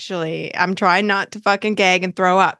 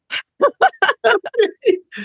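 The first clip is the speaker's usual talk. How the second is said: high wheezing laugh; high cackle; 13 semitones higher than usual. Real, not acted. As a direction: laugh till near tears. Laughing near crying.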